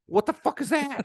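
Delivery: hammed-up Italian disbelief; mock outraged Italian. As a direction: act shocked like an Italian parent. Italian parent mocks school ban.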